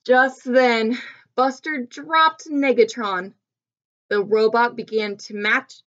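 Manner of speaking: ordinary delivery, nothing marked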